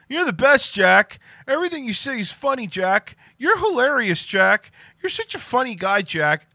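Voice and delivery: high, loud fawning yes-man; sycophantic sidekick. Retold or imitated. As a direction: gush over Jack like a groupie. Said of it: "his version of Stevie".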